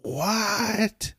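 high, stunned disbelief; baffled what. Retold or imitated. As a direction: caught completely off guard. Pharisees stumped by Jesus's question.

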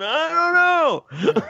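high, slow, dragged-out dopey whine; mock dumb voice. Host mocking how he looks on camera, high and dragged out. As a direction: stretch it out like a clueless fool. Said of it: "you look stupid going".

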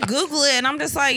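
ordinary talk, nothing marked

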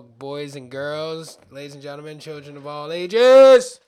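slow, booming ringmaster call. Pretend. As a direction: announce it to the big top. Showman address to audience.

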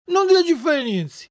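put-on high childish wail; tearful protest. Puppet brushing off the fairy, tearful protest. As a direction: whine it out stubbornly. Puppet's tantrum over the medicine.

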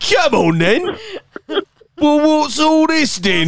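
very high, laughing mock Cockney; Cockney accent. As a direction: squawk it in an over-the-top Cockney accent. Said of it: "Cockney accents".

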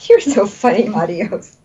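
high, laughing fondness. Real, not acted. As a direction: chuckle as you say it. Laughing at patient.

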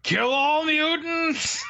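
slow, high mock battle cry; hammed-up soldiers shouting orders; mock army shout. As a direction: bellow it like a cartoon soldier. Army yelling at mutants.

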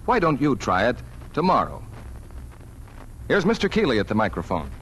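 usual speaking voice

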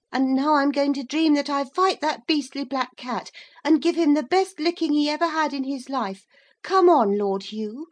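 put-on defiant boy turned cat. A narrator's reading. Maurice dares Lord Hugh to fight him, defiant. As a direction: throw down a fighting challenge, high and excited.

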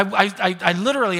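ordinary talk, nothing marked

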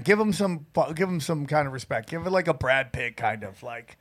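low, soft murmur; low, hushed coaching. Shown as a demonstration. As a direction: suggest it quietly and deep. Coaching a more respectful voice.

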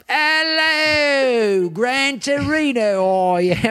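hammed-up British accent, pitched high and drawn out; high, slow caricature of a British accent. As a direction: stretch it out in a mock-British voice. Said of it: "but with British accents".

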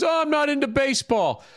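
whiny put-on fan's excuse; mocking Yankee fans. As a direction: sneer the excuses like a sore loser. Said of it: "All you fucking Yankee fans".

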